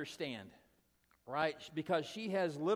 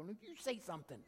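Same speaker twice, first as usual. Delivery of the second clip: hushed, higher-pitched comeback; soft, secretive bickering; mouthed retort; 4 semitones higher, 7 dB quieter than usual. Retold or imitated. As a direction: pass it back under your breath. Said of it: "she mouths".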